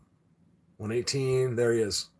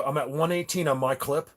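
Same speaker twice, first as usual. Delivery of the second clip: normal talking voice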